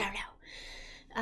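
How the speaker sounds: sullen mumble. Retold or imitated. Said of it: "I'm like".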